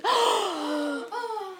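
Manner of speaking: sharp, high gasp of astonishment; crowd gasp. Acted. Reader voices the crowd watching the cage hold, sharp gasp. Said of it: "The crowd gasped".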